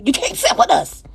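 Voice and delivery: mock Mean Girls snub; mean girl quip. Quoting Mean Girls line.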